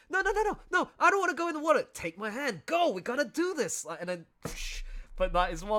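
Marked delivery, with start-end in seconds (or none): 0.1-3.8 high, frantic mock panic, louder. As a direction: squeal it like a panicking kid in water. Imagined panicked scene in water.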